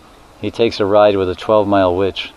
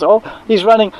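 very high panicky squeal; a tiny boy yelping in fright; scared child cry; 13 semitones higher, 3 dB louder than usual. Retold or imitated. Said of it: "Krishna pulls".

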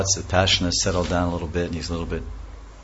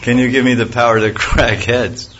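louder and higher, almost shouted; loud greedy demand; 5 semitones higher, 8 dB louder than usual. Retold or imitated. Demon repeats his demand to Shiva, loud and higher.

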